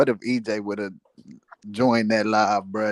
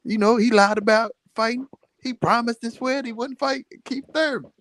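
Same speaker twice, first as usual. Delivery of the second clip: Crawford mocked in a much higher voice; 10 semitones higher than usual. Friend retells Crawford calling him a liar, high mocking. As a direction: pitch up high, teasing and giggly.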